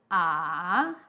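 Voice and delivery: long "ah" dipping low, then rising; third tone. Shown as a demonstration. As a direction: drop "ah" to the bottom, then swing it up. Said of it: "to apply the third tone".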